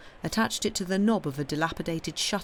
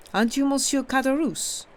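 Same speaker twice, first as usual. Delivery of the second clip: put-on higher-pitched question in a thick Italian accent; heavy Italian accent; 9 semitones higher, 4 dB louder than usual. A narrator's reading. Priest questioning the innkeeper, heavy Italian accent. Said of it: "with a very heavy Italian accent".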